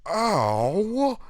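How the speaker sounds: sharp high yelp of pain. Acted. Bartender struck in the face, high yelp of pain. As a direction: yelp like a blow to the nose.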